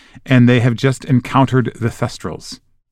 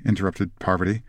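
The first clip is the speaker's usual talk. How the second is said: ordinary delivery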